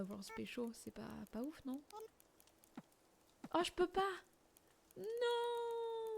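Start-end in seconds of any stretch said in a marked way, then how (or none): 3.5-4.1 high, loud exasperated cry; a high yelp of frustration; frustrated outburst. Real, not acted. Frustrated at the game. 5.0-6.2 long drawn-out high "non"; high squeal. Real, not acted. Frustrated at the game.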